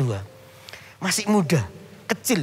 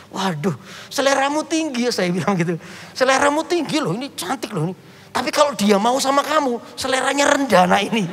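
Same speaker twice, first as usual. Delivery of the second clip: joking tease; 6 semitones higher than usual. Retold or imitated. Pastor jokes with the boy.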